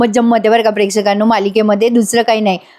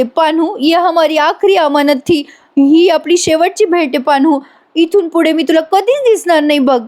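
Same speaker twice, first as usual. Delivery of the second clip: theatrical tearful farewell; 5 semitones higher than usual. Retold or imitated. Payal bids Panya a final farewell, mock tearful. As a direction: play a melodramatic last goodbye. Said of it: "रडते पडते आणि नाटक करत म्हणते".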